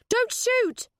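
high, pleading yell; urgent shout. A narrator's reading. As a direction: shout it to stop someone firing. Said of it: "she shouted".

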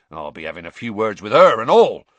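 loud, grim threat. A narrator's reading. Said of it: "said Hagrid grimly".

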